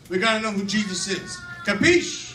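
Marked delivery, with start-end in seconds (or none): 1.7-2.4 capiche called out high and loud, rising at the end. Shown as a demonstration. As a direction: call out capiche to the class, rising like a question. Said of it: "if I say capiche".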